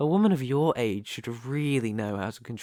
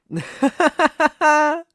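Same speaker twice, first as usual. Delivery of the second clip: high, loud staccato cackle; ringing, amused cackle; delighted villain laugh; 10 dB louder than usual. Acted. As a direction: burst out laughing at the trick.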